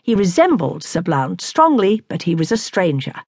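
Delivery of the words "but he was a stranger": plain reading voice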